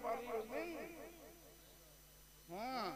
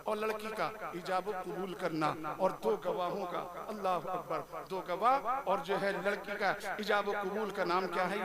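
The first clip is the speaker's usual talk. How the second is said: ordinary delivery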